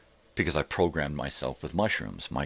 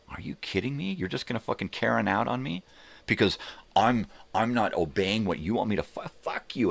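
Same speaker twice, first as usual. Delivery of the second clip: higher, incredulous mock rant; retold angry retort; 6 semitones higher than usual. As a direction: act out the outraged comeback, pitched up.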